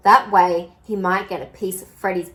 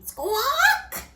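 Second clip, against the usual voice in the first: shrill squawking bird cry; high pelican squawk. A narrator's reading. Narrator voicing the pelican's squawk. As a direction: screech it like a big bird.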